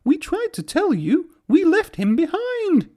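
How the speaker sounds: very high, upset coach voice; high tearful coach voices; tearful coaches. A narrator's reading. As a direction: wail that they tried. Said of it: "sobbed Annie and Clarabel".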